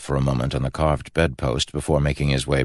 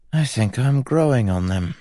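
higher-pitched, lightly muttered aside; 8 semitones higher than usual. A narrator's reading. Brother beside the drunken prince once guards leave, muttered. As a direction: mutter it in character. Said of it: "he muttered".

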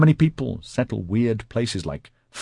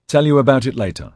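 raised, louder offhand answer; automatic reply; 5 semitones higher, 5 dB louder, faster than usual. A narrator's reading. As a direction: answer on reflex, a bit higher and louder. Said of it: "Mark said automatically".

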